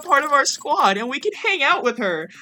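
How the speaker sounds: high-pitched breakneck joy, real elation; freaking out. Real, not acted. Player celebrates villain joining the squad, freaking out. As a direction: gush in a high, thrilled cry. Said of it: "you missed me freaking out".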